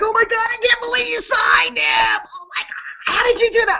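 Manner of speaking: high, excited squeal of disbelief, voice jumping right up; freaking out. Retold or imitated. Fans freaking out over the big signing, high excited squeal. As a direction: gasp it out like fans who just heard big news. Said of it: "people were, like, freaking out".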